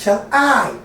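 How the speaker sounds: "shall I" with a short "shall" and a long, falling "I"; stress on I. Shown as a demonstration. Teacher marking the iamb, "shall I" stressed on "I". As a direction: lean hard on "I" in "shall I". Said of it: "The I is stressed".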